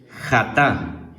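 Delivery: jatá said with stress on the last syllable, xa-TA; jatá pronounced xa-TA, final syllable stressed; Hebrew word jatá. Shown as a demonstration. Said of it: "¿Cómo se escribe?".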